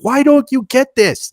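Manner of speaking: high, slow exasperated plea; mock upset. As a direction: plead in put-on frustration, stretched out. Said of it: "because you're gonna get upset".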